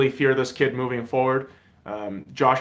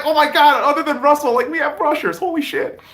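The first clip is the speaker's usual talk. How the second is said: mock amazement; 12 semitones higher, 4 dB louder than usual. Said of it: "I didn't know, like".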